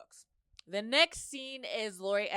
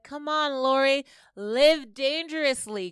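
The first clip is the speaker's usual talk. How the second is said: higher, louder teasing push; playful coaxing; 5 semitones higher, 8 dB louder than usual. Retold or imitated. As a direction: egg her on playfully. Jace coaxing Lori.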